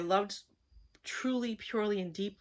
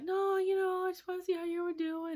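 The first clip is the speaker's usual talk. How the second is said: much higher, sugary imitation of him; mock his innocent sweet-talk, pitched way up; 12 semitones higher than usual. Imitating the ex explaining his call, high sugary voice.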